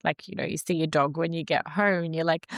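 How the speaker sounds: ordinary talk, nothing marked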